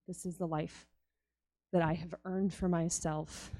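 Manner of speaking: quiet, heavy self-blame, softly; despairing inner voice. Retold or imitated. Woman at the well to herself, quiet and defeated. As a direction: murmur it like she believes she deserves nothing.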